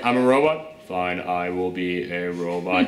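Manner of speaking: robotic deadpan. Retold or imitated. Reviewer mocks Scarlett Johansson's flat robotic line, low and slow. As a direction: drone it like a bored robot. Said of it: "she was like".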